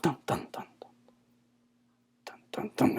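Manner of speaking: breathy whispered dramatic sting; whispered dun dun dun. Acted. Villain stalks off, whispered dramatic sting. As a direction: hum a spooky sting under your breath.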